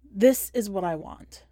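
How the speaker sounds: plain reading voice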